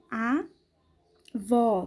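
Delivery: AVÓ split in two, A... vó, open ó; open vowel demo. Shown as a demonstration. Teacher shows pupils the acute accent, split open A, VÓ. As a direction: say A, pause, then open vó. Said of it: "indica a pronúncia da vogal aberta".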